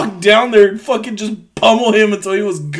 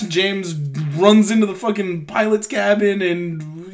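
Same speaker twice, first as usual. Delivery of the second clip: ordinary delivery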